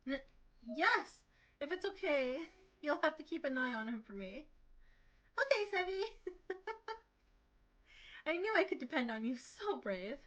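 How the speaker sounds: put-on high, gushing girly voice; sugary girl voice. Nini greeting the pair, gushing and clinging. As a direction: coo at the boys like a clingy sweetheart.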